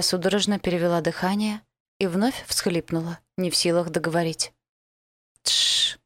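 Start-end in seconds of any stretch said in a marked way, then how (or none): 5.4-6.0 hushing. Acted. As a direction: hush her gently. Elf hushing crying girl.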